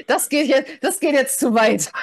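high, breaking out of a laugh; real laughter. Real, not acted. Guest protests the host's joke, laughing. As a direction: protest while cracking up.

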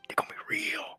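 whispered threat; menacing whisper. Acted. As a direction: hiss the threat quietly. Boss delivering a threat.

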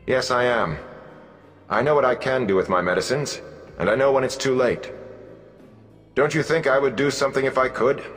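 emotionless and firm. A narrator's reading. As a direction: hold the line without giving in to feeling. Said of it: "her voice emotionless and firm".

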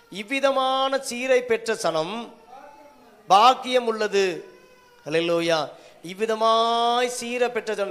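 loud, high, drawn-out preacher's proclamation; ringing, slow scripture declamation; declaimed scripture reading. Acted. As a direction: proclaim the verse slowly at full voice, stretching the words. Reads the verse out to congregation.

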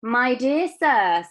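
loud, slow, grand salutation; effusive greeting. A narrator's reading. Wonka effusively greets Mister Bucket.

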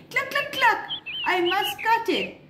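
high cluck cluck cluck; hen clucking. A narrator's reading. Hen deciding to cut the wheat, clucking. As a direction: cluck like a busy little hen. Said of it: "she said".

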